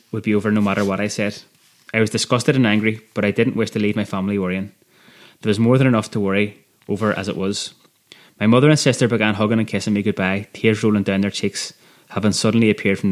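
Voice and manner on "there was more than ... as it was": usual speaking voice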